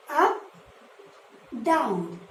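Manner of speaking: Up rising high then down dropping low. Shown as a demonstration. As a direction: voice climbs on Up and falls on down. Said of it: "Like this".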